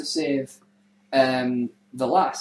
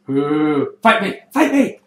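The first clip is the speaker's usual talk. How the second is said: taunting assassin; 8 dB louder, slower than usual. Retold or imitated. Assassin taunting his foe, loud eager challenge. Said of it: "So the assassin goes".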